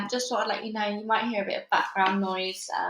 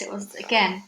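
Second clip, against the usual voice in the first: usual speaking voice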